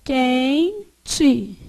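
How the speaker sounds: "Quente" split as quen, te with a gap; syllable by syllable. Shown as a demonstration. Teacher asks how to separate it, syllable by syllable. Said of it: "Como é que eu separo?".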